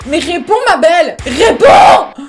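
loud, high yell at the screen; urging shout. Real, not acted. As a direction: shout at her to answer. Urging the silent bride.

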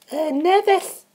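put-on high-pitched girly falsetto. Contestant [name] answering the host, girly voice. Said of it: "why did you do that girly voice".

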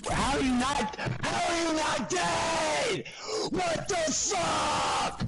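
high, slow, drawn-out furious yelling; real gamer rage, each word stretched; outraged disbelief. Real, not acted. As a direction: yell it out in stunned disbelief. Outraged at opponent surviving.

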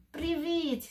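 high, happy little shout; joyful call. Acted. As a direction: call the friends over with delight. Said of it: "strigă bucuros".

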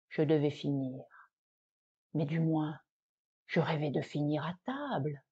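low, hushed lament after a heavy sigh of a first word; mournful resignation. Acted. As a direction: sink into deep, resigned regret. Cheese laments its inevitable end. (résignation plaintive, voix basse)

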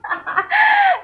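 high-pitched burst of laughter. Real, not acted. Mother amused by child's play, high laugh.

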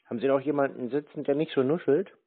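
ordinary delivery

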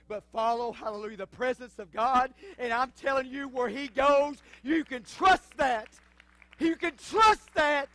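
loud, high preacher's cry, slowing at the end; full-force preaching. Acted.

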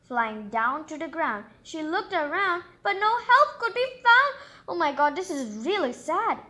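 ordinary delivery, nothing marked

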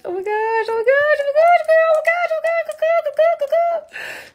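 very high, rapid-fire squeal; giddy high-pitched freak-out; excited squealing. Real, not acted. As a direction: squeal it over and over in runaway excitement. Overcome with excitement thanking viewers.

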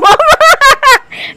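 loud, high-pitched burst of laughter; laughing. Real, not acted. Woman laughing at the interviewer's comparison, loud laughter.

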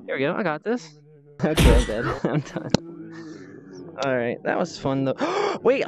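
ordinary talk

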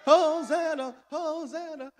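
high, loud joyful cry of Hosanna; ringing crowd shout, fading on the third. Retold or imitated. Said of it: "people were around him shouting".

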